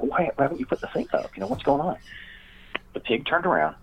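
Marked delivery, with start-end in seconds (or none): 0.0-2.0 high, strained mock panic; retold frantic question. The man demands to know why the pig isn't penned, mock panic. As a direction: squeak it out in a hammed-up panic. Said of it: "It's like".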